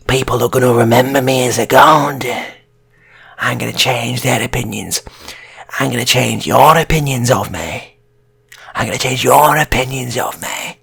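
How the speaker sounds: loud, booming self-proclaimed god; grandiose boast. Acted. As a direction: shout it like a deluded hero. Gunman boasts to police.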